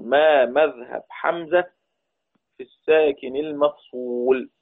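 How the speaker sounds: slow, drawn-out words for note-takers; measured teacher's dictation pace. Real, not acted. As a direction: dictate it slowly so students can write. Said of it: "نكتب مع بعض ونركز".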